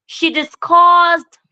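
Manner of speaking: discussed drawn out high and loud; slow emphatic discussed; stress on discussed. Shown as a demonstration. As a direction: stretch and lift discussed. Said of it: "not she demanded for explanation".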